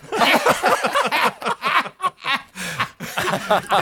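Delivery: high, rising laugh. Real, not acted. Host laughing about Carl Reiner's stunned face, high rising laugh. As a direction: laugh at the picture of his face.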